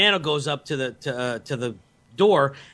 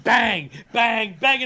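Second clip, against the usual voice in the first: high, loud shots imitated by voice; shouted gunshots; 6 semitones higher, 3 dB louder, much slower than usual. Storyteller acts out Billy shooting cows, shouted bangs. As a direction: fire off the words like gunfire.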